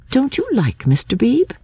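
high-pitched, thoughtful question. A narrator's reading. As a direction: ask it pensively in a high voice. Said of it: "she asked thoughtfully".

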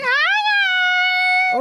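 mock squealed hello, extremely high and loud; imitating high-pitched greeting. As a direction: mimic a greeting shot way up into a squeak. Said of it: "It was just really high pitched".

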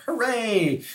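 soft, high-pitched little hooray; mock cheer. Father imitating his kids at safari news, mock excited cheer. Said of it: "they were like".

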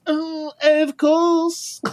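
very high, slow, drawn-out "of course"; smug French attendant. Retold or imitated. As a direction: sound knowingly superior. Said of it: "She goes".

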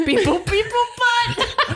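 laughing droid beeps; Star Wars droid. Pretend. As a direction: beep like a little droid, laughing. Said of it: "a little Star Wars droid".

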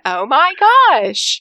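high, drawn-out mock amazement; gullible astonishment. Co-host mimics herself watching the show, astonished. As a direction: stretch it slowly into a hammed-up swoon of disbelief.

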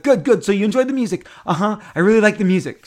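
high, loud eager back-and-forth; prompting exchange. Retold or imitated. Moderator coaxing the fan about the music, eager. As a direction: lead her on brightly.

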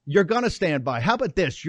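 ordinary delivery

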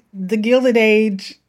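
slow, drawn-out British accent; mock British accent. Shown as a demonstration. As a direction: say the title like a British period drama. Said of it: "broke out in Old English".

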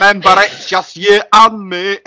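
high, put-on Scottish movie voice; rally it like a Scottish hero; Braveheart Scottish accent. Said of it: "Fucking Braveheart".

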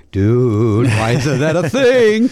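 slow, stretched-out mock amazement; hammed-up slow question; drawn-out catchphrase. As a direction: drag out "dude" and every word after it. Catchphrase repeated in ad.

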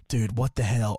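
low, mock-baffled aside; hammed-up low-voiced disbelief; acted bafflement. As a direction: drop the pitch and play stunned. Imagines the artist baffled by success.

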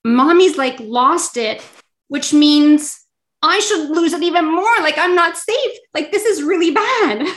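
put-on high, rising child voice gone frantic; child's panicked thoughts. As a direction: voice the scared kid inside the tantrum. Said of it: "They're thinking".

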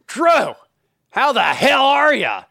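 high, slow, loud overjoyed hello; thrilled to see you after twenty years; enthusiastic greeting. Retold or imitated. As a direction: greet him like a long-lost friend from the desert. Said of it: "you just went through a desert".

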